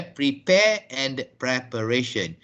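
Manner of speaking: "prepare" as pri-PAIR, "preparation" as prep-uh-RAY-shun; correct pronunciation. Shown as a demonstration. Teacher recapping the pair for class, correct pronunciation.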